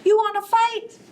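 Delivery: high, loud cocky call; grouchy ladybug. Acted. Ladybug calling out to the elephant, high and cocky. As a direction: shout up at the big beast. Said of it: "said the ladybug".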